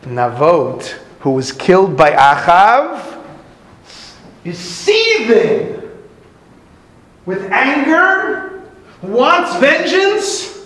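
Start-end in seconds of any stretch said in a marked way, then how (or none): 4.4-10.6 much higher and very slow; drawn-out emphasis. A narrator's reading. Preacher building tension over Navot's revenge, slow and high. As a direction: stretch each word out to build the tension.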